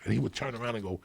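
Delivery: normal talking voice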